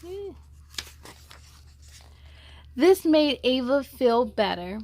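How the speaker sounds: ordinary delivery, nothing marked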